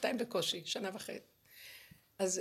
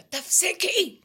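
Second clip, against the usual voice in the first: sharp raised yell, higher and louder; a retold angry shout of stop; 8 semitones higher, 6 dB louder, much slower than usual. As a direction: shout her down to make her stop. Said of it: "ואז צעקתי ואמרתי לה".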